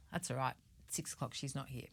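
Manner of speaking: quietly shrugging it off; calm, unbothered. Retold or imitated. Imagined partner calmly waiting at dinner, soft and unbothered.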